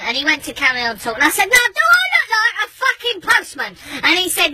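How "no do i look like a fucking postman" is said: high, shouted repeat stressing "fucking postman"; insistent outrage. Retold or imitated. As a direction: cut him off and demand an answer. Insistent repeat after being ignored.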